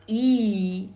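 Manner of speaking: E held long and loud; isolated vowel sound. Shown as a demonstration. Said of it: "I am going to pronounce".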